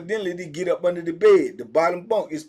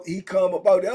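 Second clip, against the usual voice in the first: ordinary talk, nothing marked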